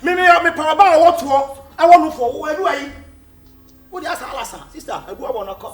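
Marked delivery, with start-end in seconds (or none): 0.0-2.9 loud retort. Real, not acted. Arguing back loudly.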